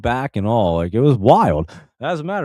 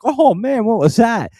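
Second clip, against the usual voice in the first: high, loud burst of shock; startled exclamation; 13 semitones higher, 5 dB louder than usual. Retold or imitated. Man reacting as the chain rips his finger, startled and loud. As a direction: cry out in sudden alarm.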